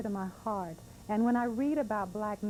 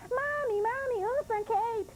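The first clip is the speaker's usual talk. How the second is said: put-on little girl; slower than usual. Little girl begging again.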